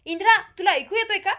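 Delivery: desperate to be heard, raise it up; loud tearful call. A narrator's reading. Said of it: "Tanisha जोरात रडते".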